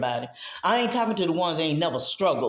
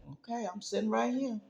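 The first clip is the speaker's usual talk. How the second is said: quiet voice of God; 3 semitones higher, 8 dB quieter than usual. Retold or imitated. God speaking softly to her as she tries to sleep, hushed. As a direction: murmur it softly like God at the bedside. God's voice at night, narrated.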